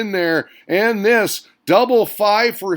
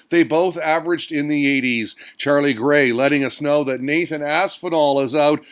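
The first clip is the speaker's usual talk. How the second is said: ordinary talk, nothing marked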